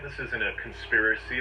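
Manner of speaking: ordinary talk, nothing marked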